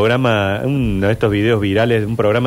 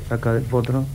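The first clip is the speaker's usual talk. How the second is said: much softer, hiding; hushed commentary; 5 dB quieter than usual. Retold or imitated. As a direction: commentate under your breath. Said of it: "medio escondido con un celular".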